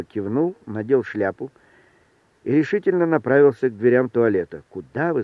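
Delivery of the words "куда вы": ordinary delivery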